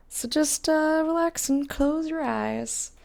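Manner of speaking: high, slow, lulling voice; soothing voice. Pretend. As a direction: ease them into rest, slowly. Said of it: "Such soothing stream audio tonight".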